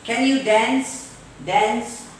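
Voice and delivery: DANCE with front "a" as in cat; slow question, cat vowel on DANCE; American dance. Shown as a demonstration. Teacher giving American example, DANCE with cat vowel. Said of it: "In American English".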